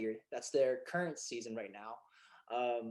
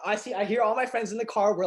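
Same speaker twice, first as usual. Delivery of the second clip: normal talking voice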